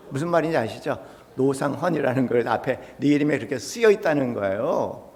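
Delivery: ordinary talk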